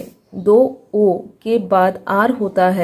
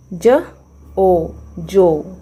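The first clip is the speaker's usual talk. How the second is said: slow sounding out; much slower than usual. Shown as a demonstration. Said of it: "sounding out then blending".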